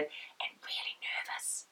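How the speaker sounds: half-whispered aside; hushed, whispery admission; whispered confession. Real, not acted. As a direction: drop to a whisper, as if confiding. Confides nerves in a dropped whisper.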